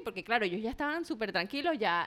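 ordinary talk, nothing marked

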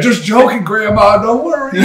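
hammed-up "only kidding" reassurance; mock reassurance.

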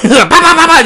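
loud high bah bah gunshot noises; mock gunshots. Imitating gunshots, loud and high. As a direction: spit out gunfire sounds.

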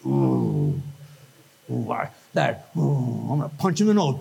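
low, halting oohs of irritation; mock seething. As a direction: act out barely held-back annoyance. Acts out irritated churchgoer.

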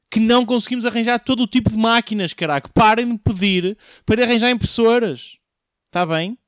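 loud, fed-up outburst; exasperated rant. Acted. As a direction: vent at everyone asking. (desabafo irritado e alto)

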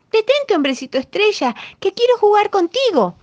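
high, loud shout. A narrator's reading. Crab shouts after the star man, high and loud. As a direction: holler to make him stop. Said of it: "le gritó".

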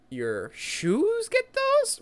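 high, slow, disbelieving question; incredulous. Real, not acted. To his co-host about Spider-Man's shoes, high incredulous drawl. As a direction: stretch it out in mock disbelief.

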